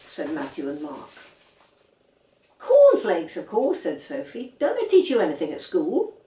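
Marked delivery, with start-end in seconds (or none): 2.6-6.2 high, loud, know-it-all; as if it were obvious; scornful child. A narrator's reading. Girl mocking her brothers' ignorance, high and scornful.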